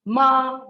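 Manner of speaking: MA sustained high and level. Shown as a demonstration. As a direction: hold MA long on one high note. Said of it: "is high level pitch".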